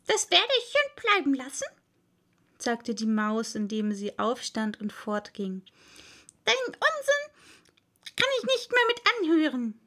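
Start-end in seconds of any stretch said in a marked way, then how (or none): 0.1-1.7 huffy mouse. A narrator's reading. Mouse refuses. 6.5-9.8 high, huffy mouse voice; offended mouse. A narrator's reading.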